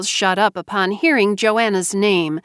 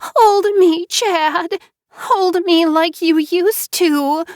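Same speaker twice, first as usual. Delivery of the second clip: high, slow, loud teary plea; cling to him with your voice; tearful begging; 11 semitones higher, 4 dB louder, slower than usual. Acted. Wife begging husband to hold her, high tearful plea. Mara Joy crying.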